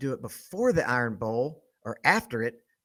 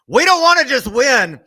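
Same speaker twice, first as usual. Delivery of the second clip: much higher, much louder shouted war cry; furious shout; 11 semitones higher, 10 dB louder than usual. Real, not acted. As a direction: roar every word like a battle cry. Rival hate-week rant climax.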